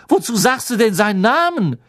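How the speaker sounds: high, loud frightened rebuke; alarmed. Acted. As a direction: still shaken by the name. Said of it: "keuchte Stan".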